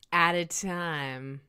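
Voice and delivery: slow, drawn-out romantic purr; playfully sultry, slow delivery; Valentine's Day voice. Pretend. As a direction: stretch it out with a little flair. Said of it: "it's my Valentine's Day voice".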